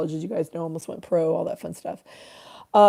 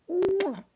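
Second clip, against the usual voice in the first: high, light "mwah" kiss sound; blown kiss; 11 semitones higher, 6 dB quieter than usual. Real, not acted. Streamer sending viewers love, high kiss sound. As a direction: smack a little kiss.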